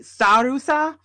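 Sarusa in a Japanese accent; Japanese pronunciation. Shown as a demonstration. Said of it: "pronounced with the Japanese accent".

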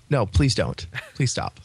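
ordinary talk

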